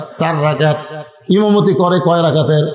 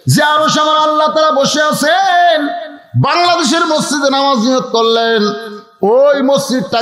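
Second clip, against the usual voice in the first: high, chanted sermon delivery; full-force preaching; 9 semitones higher than usual. Real, not acted. Preacher urging the congregation to pay attention, full-force preaching.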